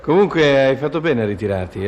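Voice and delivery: ordinary delivery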